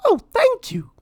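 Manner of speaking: Minnie pleading. A narrator's reading. Minnie begging Lord Gurr, high pleading mouse voice. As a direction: beg sweetly for food. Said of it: "cried Minnie".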